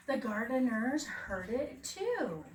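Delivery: ordinary delivery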